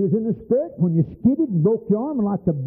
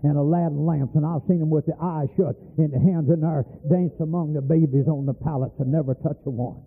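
normal talking voice

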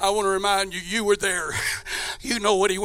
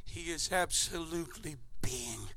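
whisper; 8 semitones lower, 21 dB quieter than usual. Real, not acted. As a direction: let it fall to a whisper. Preacher drops to whisper on humiliation.

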